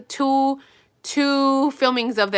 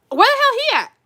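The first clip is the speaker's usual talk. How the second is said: much higher, louder excited call; excited yell; 4 dB louder than usual. Real, not acted. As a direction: call out eagerly, wanting him. Excited about the good guy.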